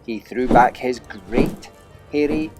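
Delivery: plain reading voice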